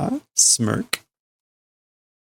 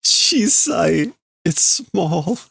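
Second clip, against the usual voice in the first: mock crying; 8 semitones higher, much slower than usual. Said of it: "And she started to cry".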